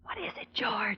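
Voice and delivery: hushed unvoiced whisper. Friend whispering to George while the villain reads. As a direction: breathe the question so the gunman can't hear. Tense hush near gunman.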